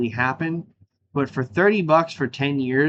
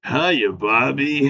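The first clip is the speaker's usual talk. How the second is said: slow, crooning mock seductive voice; Ken's sexy man voice; much slower than usual.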